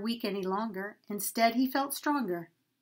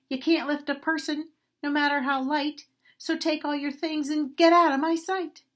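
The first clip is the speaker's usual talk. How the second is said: rising, louder, higher angry scold; huffy scolding; 6 semitones higher, 6 dB louder than usual. Acted. Mean owner driving the donkey away, huffy and loud. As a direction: huff at the useless donkey, building up. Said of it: "said with a huff".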